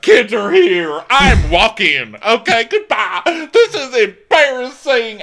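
loud, high, drawn-out outrage; hammed-up scandalized shrieking; mock screaming. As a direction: imitate a hysterical mother making a scene. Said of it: "keeps screaming".

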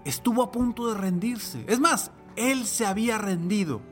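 loud, high, rousing podcast host; booming motivational speech. Acted. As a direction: pump up the listener with big energy. Podcast intro, raised energy.

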